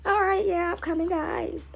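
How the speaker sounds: high, soft mock-tearful mother; hiding tears. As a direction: answer the kids while holding back tears. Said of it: "Kids coming".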